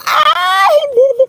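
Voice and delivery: high surprised yelp; sudden high cry; startled cry. Real, not acted. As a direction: yelp as if caught off guard. Goat startles her.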